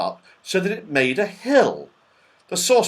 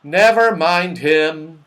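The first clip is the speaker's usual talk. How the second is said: raised, drawn-out brush-off; loud dismissal; 4 semitones higher, 8 dB louder, slower than usual. A narrator's reading. Moonface tells children to ignore Saucepan Man, loud. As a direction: wave it away loudly and slowly. Moonface waves the matter away.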